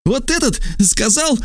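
very high-pitched cry; shouting accusation. A narrator's reading. Trasul denounces the stranger to lictors, high shouted accusation. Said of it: "крикнул Трасул".

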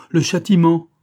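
high, loud, tormented exclamation; loud cry of damnation; anguished outcry. A narrator's reading. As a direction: wail it out at full pain. Louder repeat of the cry.

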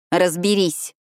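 curt mild snap; snapped order. A narrator's reading. Alina snaps at Phoebe releasing her wrist. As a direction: cut her off sharply. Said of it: "— огрызнулась я".

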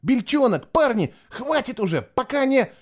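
high-voiced alarmed call; urgent plea. A narrator's reading. Boy calling his monster friends to stop, urgent and high. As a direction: call out high, breaking off unfinished. Acted cry to monsters, cut off.

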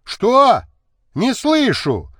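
high, loud call across the house; shouted question. A narrator's reading. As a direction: shout it up high, demanding an answer. Husband shouting to servant, can't hear.

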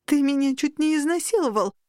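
high-pitched, outraged accusation; indignant outburst. A narrator's reading. Girl breaking free of the incubus, high and outraged.

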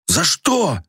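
high, indignant outcry; angry cry. A narrator's reading. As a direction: shout it up high in anger. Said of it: "гневно воскликнула она".

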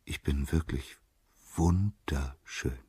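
soft, lingering self-admiration; hushed, enraptured with himself; self-loving sigh. A narrator's reading. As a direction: draw the last word out slowly, besotted. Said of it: "seufzte er".

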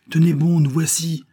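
high loud call. A narrator's reading. The boy calls to the swimmer, loud call. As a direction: shout it across the water, high. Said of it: "lui lançait".

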